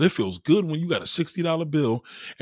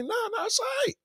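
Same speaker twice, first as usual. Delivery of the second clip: squeaky high voice to calm her down; placating falsetto; 4 dB quieter than usual. Retold or imitated. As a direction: go way up high to smooth things over. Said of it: "I'm like".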